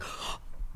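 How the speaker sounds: soft acted gasp of surprise; quick intake of breath, retelling shock; quiet imitation gasp; mock gasp. Acts her reaction to his question.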